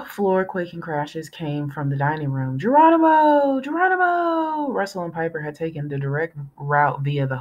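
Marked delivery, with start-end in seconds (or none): 2.6-4.7 high whooping yell; shouted. A narrator's reading. Boys yelling as they leap through the ceiling. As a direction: yell it while jumping down. Kids leaping through the hole.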